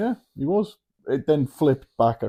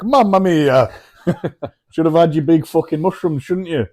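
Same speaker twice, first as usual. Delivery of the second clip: hammed-up Italian accent, high and loud; laughing through a cartoon Italian voice; mock Italian; 7 semitones higher, 6 dB louder than usual. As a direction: lampoon a lone Italian at the bottom. Mocking a losing Italian athlete.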